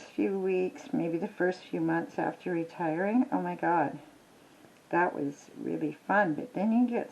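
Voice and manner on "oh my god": ordinary talk, nothing marked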